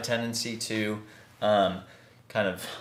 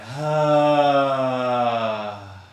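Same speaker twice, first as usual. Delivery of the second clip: long, loud open sigh, falling away; voiced sigh; 4 dB louder than usual. Shown as a demonstration. Teacher showing students how to release breath, long voiced sigh. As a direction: let all the breath out on an audible "haah". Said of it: "let it out on a sigh".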